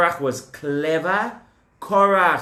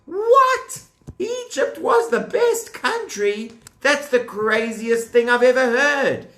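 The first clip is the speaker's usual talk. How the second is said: astonished; 8 semitones higher than usual. Acted. Teacher mock-stunned by the rebels' words, very high. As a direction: gasp it out, stunned. Teacher feigns shock at claim.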